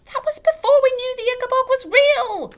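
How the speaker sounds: very high, loud screech. A narrator's reading. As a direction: shriek it in fury. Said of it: "screeched Missus Beamish".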